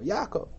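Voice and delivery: "Yaakov" as YA-kov; Israeli early stress. Shown as a demonstration. Teacher quoting Israelis, early stress on "Yaakov". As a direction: stress the first syllable of "Yaakov". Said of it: "But they say".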